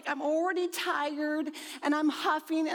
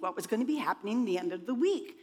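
ordinary talk, nothing marked

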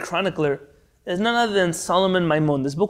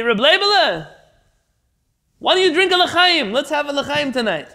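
high, loud, eager coaxing; 10 semitones higher, 5 dB louder than usual. Retold or imitated. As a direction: egg him on to have a drink.

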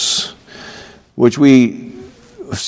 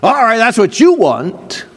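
resentful higher-pitched grumble; mock growl; 7 semitones higher than usual. Preacher imitates a wife's grudging reply, mock growl. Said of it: "instead of growling".

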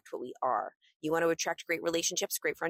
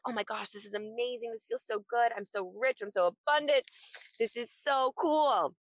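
higher-pitched giddy delight, building to the end; celebrating excitement; 5 semitones higher than usual. Acted. Celebrating herself depositing a check, growing giddy excitement. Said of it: "feeling the excitement of the celebration".

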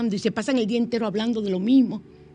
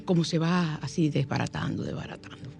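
fading to whisper; 5 semitones lower, 6 dB quieter than usual. Shown as a demonstration. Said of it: "shows the thing dissolving".

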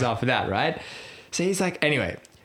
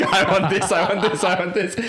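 higher, louder, laughing through the repeats; eager demanding; 8 semitones higher, 5 dB louder than usual. Retold or imitated. Captain telling the host what pitch he wants, gleefully. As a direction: grab at it like a kid in a sweet shop.

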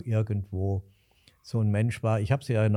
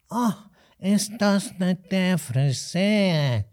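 put-on old innkeeper's drawn-out Hessian drawl; slow nasal Alsfeld dialect; 9 semitones higher, 4 dB louder, slower than usual. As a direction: stretch each word slowly through the nose. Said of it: "nasale Aussprache und ganz langsam".

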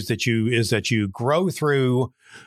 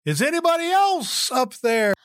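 high, pleading call upward; desperate punchline; 13 semitones higher than usual. Acted. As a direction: ask for anyone else, high and hopeful. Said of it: "And the man said".

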